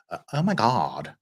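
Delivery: a hint of a valley girl gasp; lightly put-on valley girl; valley girl oh my God. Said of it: "vocal fry Valley Girl thing".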